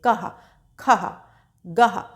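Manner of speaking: कह, खह, गह each ending in a light breathy ह; soft h after consonant. Shown as a demonstration. Teacher demonstrates the visarga sound to pupils with a light ह. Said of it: "हा की हल्के से ध्वनि निकालनी होती है".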